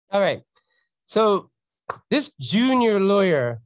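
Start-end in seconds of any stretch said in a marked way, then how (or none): 2.1-3.6 junior drawn out slowly, pushed higher; this junior lawyer, with a slow and pointed junior; stress on junior. Shown as a demonstration. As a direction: lean hard on the word junior, slow and pointed. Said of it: "I wanna emphasize the word junior".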